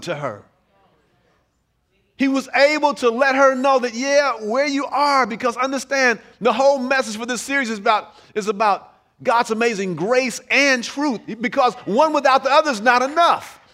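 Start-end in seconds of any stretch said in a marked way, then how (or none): 2.2-13.6 louder, rising preacher's appeal; preaching build. Acted. Pastor urges the congregation to show grace, preaching louder. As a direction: build the call to the church.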